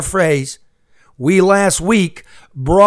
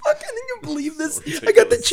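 mocking high voice; faster than usual.

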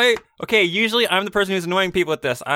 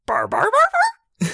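dog barking; 14 semitones higher than usual. Acted. Playing Toto the dog.

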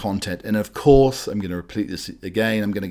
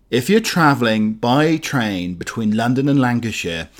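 ordinary talk, nothing marked

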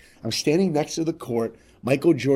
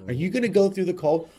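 mock taunt; 6 semitones higher, 4 dB louder than usual. Taunting the kid on bus.

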